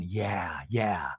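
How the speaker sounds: low, soft imitated yeahs; muted mock agreement. Imitating [name] murmuring along beside them.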